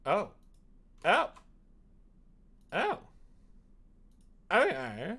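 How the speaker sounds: ordinary delivery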